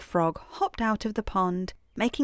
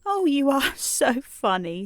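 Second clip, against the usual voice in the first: laughing; 6 semitones higher than usual. A narrator's reading. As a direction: let a laugh break into the words. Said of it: "Tearful laughed again".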